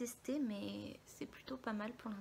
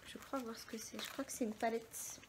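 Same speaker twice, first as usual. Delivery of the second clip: whispering; 4 dB quieter than usual. Real, not acted. Whispered guess at package.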